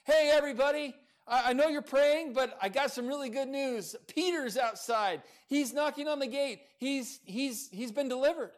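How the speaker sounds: high, loud, breaking in on the meeting; excited announcement. Retold or imitated. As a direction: burst in with thrilling news. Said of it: "she came in and interrupted".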